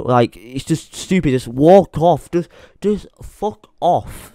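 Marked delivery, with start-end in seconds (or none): 1.3-2.3 slow angry dismissal. Real, not acted. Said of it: "I'm pissed off". 2.3-4.3 drawn-out fuck off. Real, not acted. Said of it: "I'm pissed off".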